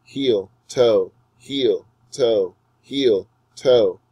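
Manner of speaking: weak "heel", strong "toe" with a falling tune; iambic stress demo. Shown as a demonstration. Teacher showing students the iamb's beat, "toe" stressed. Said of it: "The iamb is like a foot rolling from heel to toe".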